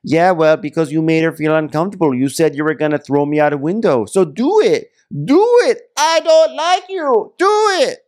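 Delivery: high loud dare, building to a shriek; imitating a screamed taunt; screaming argument. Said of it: "they're screaming at each other".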